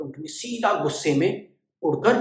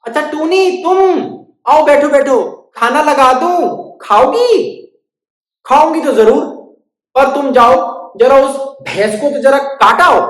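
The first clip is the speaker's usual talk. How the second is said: high, loud sing-song; welcoming host; 10 semitones higher, 9 dB louder than usual. Acted. Dog welcomes, bird demands.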